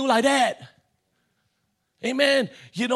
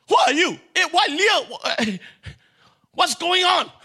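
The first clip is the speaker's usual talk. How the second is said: shocked; 6 semitones higher than usual. Acted. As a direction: sound thrown by a nasty surprise. Jacob unveils Leah, shocked.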